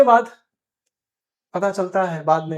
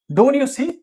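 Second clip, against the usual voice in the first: higher, mildly heated snap; mock angry retort; 6 semitones higher, 3 dB louder, much slower than usual. Milkman, irritated after the bump, asking if he's blind. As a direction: act out the irritated milkman, lightly. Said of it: "गुस्से में आया".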